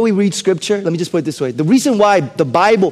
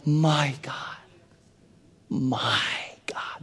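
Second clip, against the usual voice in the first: hushed anguished prayer; 4 semitones higher, much slower than usual. Acted. Pastor voicing anguished prayer.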